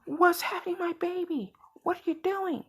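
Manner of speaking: high put-on voice of a worried mother bursting in; panicked mom voice. Inko bursting in on her son, high worried mom voice. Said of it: "Inko coming in like".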